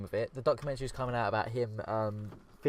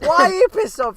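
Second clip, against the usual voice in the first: shouting; 14 dB louder than usual. Real, not acted. Mock outrage at friends.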